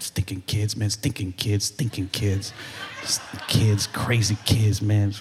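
put-on low, grumbling complaint about the kids; grumbling grump. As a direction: grumble it like a grouch who hates mornings. Grumpy person voiced.

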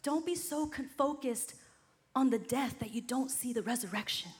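usual speaking voice

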